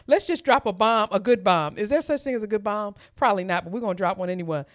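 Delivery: ordinary talk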